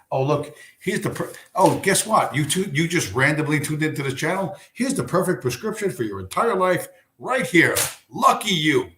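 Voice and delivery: mock cheery huckster. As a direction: parody a TV doctor handing out miracle cures. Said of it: "I can't write a fucking prescription like".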